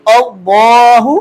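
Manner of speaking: "अल्लाहु" with thick dark L and long aa; full, heavy L. Shown as a demonstration. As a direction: recite "अल्लाहु" slowly, heavy on the double L. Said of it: "अल्लाह को पुर पढ़ा है".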